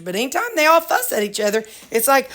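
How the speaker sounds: ordinary talk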